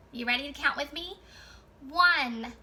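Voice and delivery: ordinary talk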